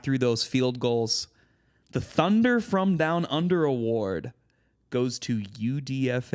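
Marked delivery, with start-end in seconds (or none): 1.9-4.3 loud, high award announcement; announcer voice. Acted. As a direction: call it like a ceremony host.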